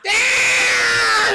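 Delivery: high, loud imitated scream; hammed-up long shriek of panic; mock scream. Acting out a man as the plow hits his house, mock scream.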